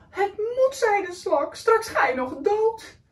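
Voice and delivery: high, near tears; desperate plea. Acted. Snail pleads with the dying whale, tearful. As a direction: beg him not to give up.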